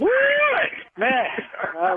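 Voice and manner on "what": very high, drawn-out yelp of surprise; real astonishment, pitch shooting up; shocked disbelief. Real, not acted. Prank victim learns cousin set him up, shocked.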